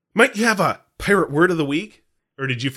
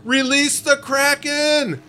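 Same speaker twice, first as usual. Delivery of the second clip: high, drawn-out theatrical cry; big booming quote, pitched way up; dramatic movie command; 13 semitones higher, much slower than usual. Acted. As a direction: bellow it slowly like an epic film command. Quotes Clash of the Titans line.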